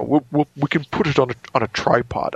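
lightly voiced English accent; casual English gent, offhand. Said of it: "British movie conversations".

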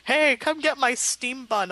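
drawn-out sales holler; mock vendor's yell. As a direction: call out like a food seller hawking buns. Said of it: "yelling at you".

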